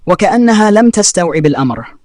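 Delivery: plain reading voice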